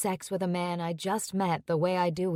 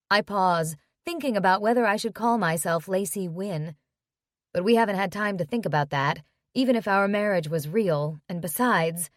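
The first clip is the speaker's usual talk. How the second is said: plain reading voice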